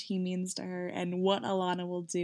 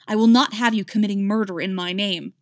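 louder, cutting rebuke; angry snap; 8 dB louder, faster than usual. Acted. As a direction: snap it at her like a king. King rebukes his champion.

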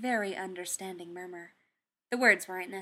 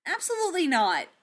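emphatic refusal; 9 semitones higher, 6 dB louder than usual. Acted. Tony refusing Steve's plan.

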